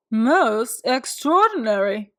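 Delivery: slow, amazed doctor voice; a lightly voiced doctor marvelling; doctor's slow, wondering exclamation; exclaiming doctor. A narrator's reading. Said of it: "he exclaimed".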